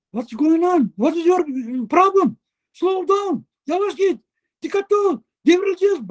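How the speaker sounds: high, panicked yelling in a loose imitation; mimicked yelling. Old partner yelling at the driver to slow down, high and panicked. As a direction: play the scared old driver shouting at him. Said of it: "Bağırıyor bu".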